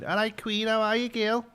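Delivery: put-on high-pitched broad Scouse accent; older Scouse. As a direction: greet them like an old Liverpudlian, pitched right up. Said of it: "a lot of the older Scouse".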